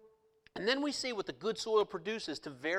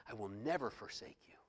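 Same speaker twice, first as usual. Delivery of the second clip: soft, lower, reassuring promise; soft lower tender promise; tender voice of Jesus; 8 semitones lower, 12 dB quieter than usual. Retold or imitated. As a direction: reassure quietly like a loving friend. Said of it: "He said".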